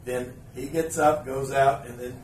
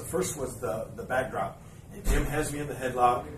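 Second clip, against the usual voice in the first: ordinary talk, nothing marked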